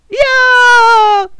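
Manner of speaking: piercing high drawn-out scream. Acted. The character's terrified scream, piercing and high. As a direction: scream as if in terror.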